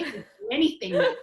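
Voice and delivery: quick words bubbling with laughter; real delighted laugh through the line. Real, not acted. Excited discovery while chatting.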